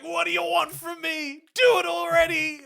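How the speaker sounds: high, loud plea hurled at the sky; imitated yelling. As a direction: yell it upward, exasperated, as a movie heroine. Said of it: "her yelling to the sky".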